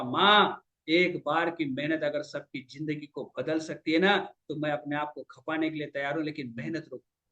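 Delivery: low, hushed reassurance to mother; quiet, heartfelt resolve; earnest son. Retold or imitated. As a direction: promise her softly, voice dropped. Son tenderly answers his mother.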